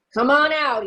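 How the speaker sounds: menacing shouted call; shouting. A narrator's reading. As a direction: shout it out to flush them out. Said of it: "he shouted".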